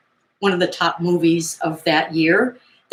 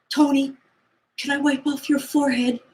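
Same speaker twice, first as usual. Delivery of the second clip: put-on high, spacey girl voice, dreamy and quiet; lightly sighing a dazzled girl's words; starstruck girl; 8 semitones higher, 4 dB quieter than usual. Retelling the movie, voicing the dazed starstruck girl. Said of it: "she comes up and she says".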